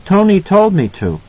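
put-on high child's voice; little boy. A narrator's reading. Danny answers his mother, little boy voice. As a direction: answer as a small boy, pitched well up.

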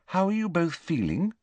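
high, tentative question. Acted. Hermione checks on two friends at breakfast, tentatively. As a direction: ask carefully, unsure of the welcome. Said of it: "she asked tentatively".

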